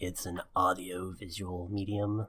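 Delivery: quiet, prissy know-it-all correction; pedantic nerd voice. Pretend. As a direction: correct him like a smug nerd. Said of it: "This is my pedantic nerd voice".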